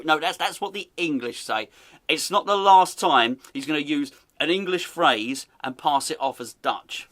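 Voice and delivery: normal talking voice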